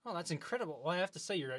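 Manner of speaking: ordinary talk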